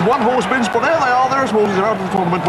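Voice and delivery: excited commentator. Acted. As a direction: call the spotted horse with rising thrill. Excited commentator spots horse.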